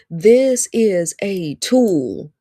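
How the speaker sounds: "This is a tool" said very slowly; drawn out for emphasis. Real, not acted. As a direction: stretch out each word of "This is a tool". Preacher repeats it for emphasis.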